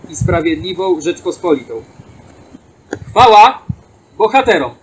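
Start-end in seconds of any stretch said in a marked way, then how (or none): 3.1-4.7 calling out. Real, not acted. Closing ceremonial cry.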